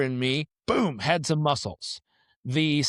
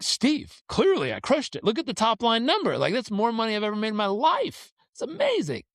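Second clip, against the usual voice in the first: high-pitched, self-assured crowing; cocky, high excitement climbing at the end; proud boasting; 8 semitones higher than usual. Retold or imitated. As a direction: brag up high, thrilled with yourself. Boasting to accountant in retold scene.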